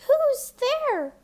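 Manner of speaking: put-on high, loud old woman's call; grandmother crying out. A narrator's reading. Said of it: "cried the grandmother".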